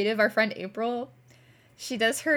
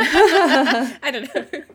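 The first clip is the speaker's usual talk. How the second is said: drawn out, breaking into laughter and louder; laughing; 5 semitones higher, 11 dB louder, much slower than usual. Real, not acted. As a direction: let the laugh swallow the words. Laughing at the word niche.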